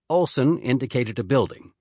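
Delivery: ordinary delivery, nothing marked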